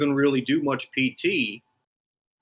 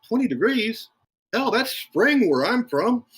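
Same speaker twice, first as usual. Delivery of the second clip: pitched way up, scoffing at the cold; mock disbelief; 10 semitones higher than usual. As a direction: scoff like it's nothing, voice jumping high. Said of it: "and I'm like".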